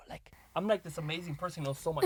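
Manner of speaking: whispered mock boast; whispered aside. Real, not acted. Boasting to his daughter, a playful whisper. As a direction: whisper it like a secret brag.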